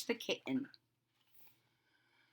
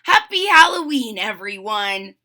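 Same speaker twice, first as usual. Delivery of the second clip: happy bark; 9 dB louder than usual. A narrator's reading. Fuji wishing the party guests well, happily. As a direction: call out a happy greeting to all. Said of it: "Fuji barked happily".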